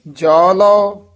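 ਜੋ ਲਓ drawn out slowly and loud; thamak hold. Shown as a demonstration. Teacher showing the thamak on ਜੋ ਲਓ, held and loud. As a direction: stretch ਜੋ ਲਓ long and strong. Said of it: "ਇੱਥੇ ਠਮਕ ਹੈ".

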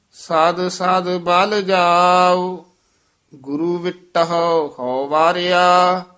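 measured, slow chanting of scripture; slow gurbani recitation. Acted. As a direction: intone each line slowly like kirtan. Recitation resumes.

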